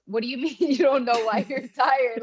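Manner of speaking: partner's incredulous reply. Retold or imitated. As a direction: play the husband, amused and incredulous. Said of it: "He goes".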